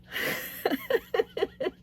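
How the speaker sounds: very high rippling giggle. Real, not acted. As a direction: burst into a high-pitched titter. Laughs after playful love declaration.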